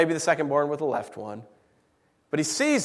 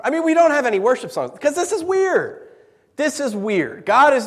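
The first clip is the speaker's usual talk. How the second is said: fervent preaching; 10 semitones higher, 8 dB louder than usual. Real, not acted. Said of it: "preaching at peak".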